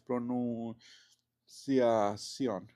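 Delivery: very slow, held syllables; drawn-out syllables. Real, not acted. Teacher spelling out a board note, drawn-out syllables. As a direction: stretch each syllable while writing it. Sounding out word while writing.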